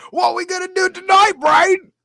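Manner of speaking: high, loud, eager cartoon sidekick; Pinky. Pinky asking Brain about tonight, high cartoon shout. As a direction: squeal the question at a high pitch. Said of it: "Pinky and the Brain".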